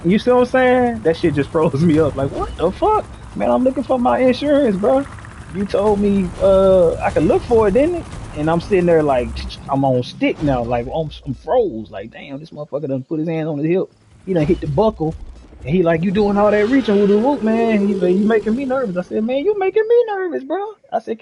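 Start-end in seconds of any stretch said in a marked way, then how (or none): 2.3-8.1 high, agitated protest to the cop; retold frantic explaining; panicked pleading. 16.0-17.9 mock nervous cop. Cop warning about reaching. 18.1-19.0 mock nervous cop. Cop says he's nervous. 19.2-20.7 very high, disbelieving retort; retold high-pitched comeback; incredulous comeback. Shot back at cop.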